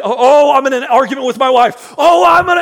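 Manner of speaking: high, loud worked-up outburst; hammed-up flustered rage; mock panic. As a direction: person in an argument with wife. Acting out a fight crisis.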